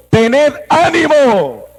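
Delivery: high, slow proclamation rising to a shout; commanding call. Acted. Preacher voicing Jesus calling to the disciples, booming shout. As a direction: ring out over the storm.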